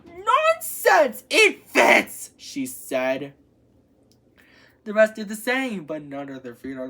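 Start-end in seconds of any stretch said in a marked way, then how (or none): 0.1-2.3 high, loud storybook stepsister outburst; shrill-pitched indignant cry; indignant insistence. A narrator's reading. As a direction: protest it loudly, pitched way up. Stepsister insists the slipper fits.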